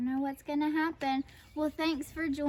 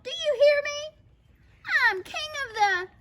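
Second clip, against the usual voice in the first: raised, domineering shout; snarling demand; 14 semitones higher, 6 dB louder than usual. A narrator's reading. Big Bark lords it over dogs, snarling. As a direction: demand to be heard, then boast. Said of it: "he snarled".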